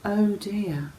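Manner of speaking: ordinary delivery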